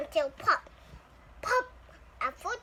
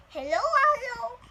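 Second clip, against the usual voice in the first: coaxing hello; 7 semitones higher, 5 dB louder than usual. Acted. Coaxing timid hatchling.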